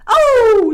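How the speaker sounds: very loud, high "oh"; startled dog cry. Acted. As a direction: howl out in alarm as the dog.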